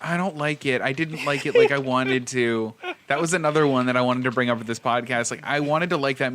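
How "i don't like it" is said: ordinary talk, nothing marked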